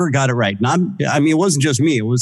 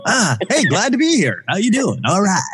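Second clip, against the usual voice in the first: higher, pumped-up club DJ patter; strip club DJ voice; 7 semitones higher than usual. Retold or imitated. Guest showing off his old club DJ voice, higher and pumped. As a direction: hype the room like a strip club DJ. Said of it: "that was my strip club D J voice".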